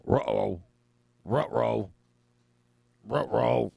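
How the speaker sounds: uh-oh said with doggy R sounds; cartoon dog uh-oh. Forgot the planned meeting, cartoon dog ruh-roh. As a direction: play a worried cartoon dog.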